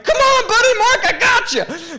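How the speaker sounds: very high, loud mock rescue holler; urgent calling out. Storyteller calling his friend across floodwater, urgent shout. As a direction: strain it high, drawing out the words.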